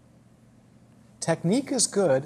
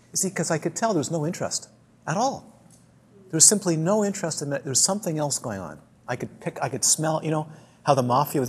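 ordinary talk, nothing marked

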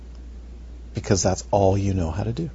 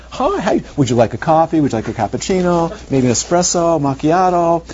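high, eager, fawning host voice offering coffee; teacher mocks a friendly warden offering coffee, high and gushing; sugary friendly warden; 6 semitones higher, 3 dB louder than usual. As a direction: play the too-friendly jailer pushing drinks on you. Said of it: "The warden is just like".

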